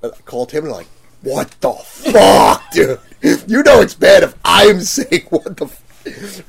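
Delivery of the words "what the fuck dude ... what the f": loud, slow, drawn-out disbelief, pitched up; shocked exclaim. Retold or imitated. Telling a friend on the phone what he showed you, loud shocked disbelief. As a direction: let the shock burst out, stretching each word.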